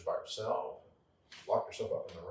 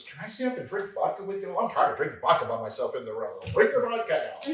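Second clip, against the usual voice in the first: high, loud, gleeful call; delighted party invite; 7 semitones higher, 9 dB louder than usual. Retold or imitated. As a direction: cheer her out of the room. Delighted invite to drink vodka.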